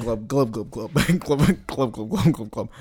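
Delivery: underwater bubbling. Pretend. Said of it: "Okay, I'm down here with you".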